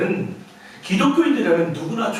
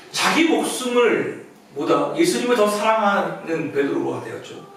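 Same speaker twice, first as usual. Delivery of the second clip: normal talking voice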